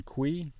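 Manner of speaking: "qui" said kwee, gliding through W. Shown as a demonstration. Learner gives Italian example, KW sound. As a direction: say "qui" with a clear KW. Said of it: "more of a qua".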